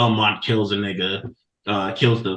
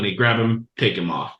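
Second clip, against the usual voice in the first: ordinary delivery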